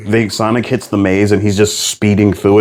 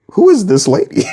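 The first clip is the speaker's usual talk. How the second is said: much higher, squeaky baffled question; mock confusion; 12 semitones higher than usual. Co-host recalling watching the movie, high squeaky confusion. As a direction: play it as a puzzled viewer. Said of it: "'Cause I'm like".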